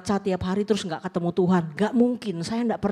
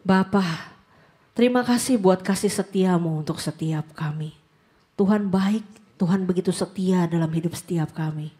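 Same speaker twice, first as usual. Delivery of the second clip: closing prayer. Acted. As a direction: pray it tenderly, unhurried.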